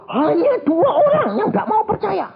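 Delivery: high-pitched accusing cry; imitated accusation. Spirit singles out two doubters, high accusing cry.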